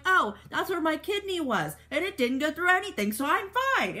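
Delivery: pitched high, tossed off like it's nothing; mock breezy. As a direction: play it as a character shrugging off a gunshot. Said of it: "and then they're like".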